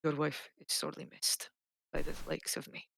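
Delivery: very soft, hushed and grave; bow low and murmur your sympathy; solemn condolence. Acted. To the grieving husband, a soft solemn condolence.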